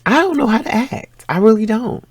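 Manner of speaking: high, bubbly squeal of delight; giddy excitement. Real, not acted. Woman gushing to her viewers about her new man, high giddy excitement.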